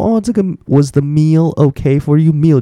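slow, careful read of the English question; slow teaching read. Shown as a demonstration. Teacher reading the waiter's line slowly for students. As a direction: model the question slowly for learners. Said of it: "就是你的餐点".

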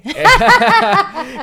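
risa alta y fuerte; carcajada aguda. Real, not acted. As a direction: suelta una carcajada. Presentador ríe fuerte. (presentador se ríe a carcajadas)

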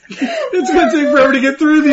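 barely getting it out while cracking up; laughing hard. Real, not acted. Hosts cracking up at names.

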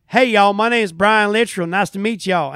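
loud, thick Southern country greeting; thick country accent. Retold or imitated. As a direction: imitate a hearty country twang. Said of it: "the thickest country accent".

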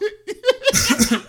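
a burst of high, loud laughter; loud real laugh. Real, not acted. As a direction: laugh out loud at your own naivety. Laughing at his own story.

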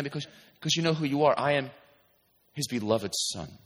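ordinary talk

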